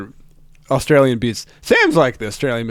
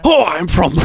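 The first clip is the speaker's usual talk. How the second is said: higher, louder comic impression through laughter; hammed-up Australian comedian voice; Sam Campbell; 5 semitones higher, 5 dB louder than usual. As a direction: throw yourself into a silly, laughing impression. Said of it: "do Sam's voice".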